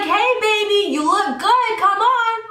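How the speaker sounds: high, exaggerated teasing come-on; mock catcall. Driver mockingly catcalling the boy at the laundromat, teasing.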